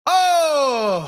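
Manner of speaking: loud high laughing shriek; a wailing burst of laughter. Real, not acted. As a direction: let out a real cackling shriek. Laughing fit.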